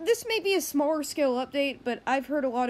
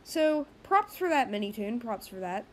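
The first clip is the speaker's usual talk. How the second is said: usual speaking voice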